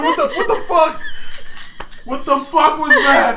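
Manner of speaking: high, laughing what the fuck; laughing retold shock. Retelling the cup hitting Chad's face, laughing. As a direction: replay the shock through laughter. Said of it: "I'm like".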